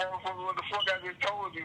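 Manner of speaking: ordinary talk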